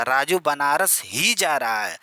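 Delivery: slow, ही pressed hard and held; stress on ही. Shown as a demonstration. Teacher showing students the stress, ही punched. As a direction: lean on ही to show the emphasis. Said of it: "बल दे के कह रहा है".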